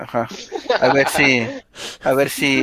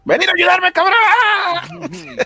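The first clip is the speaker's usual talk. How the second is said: being chased; 3 dB louder, much slower than usual. Pretend. Character grabbed, yells for help.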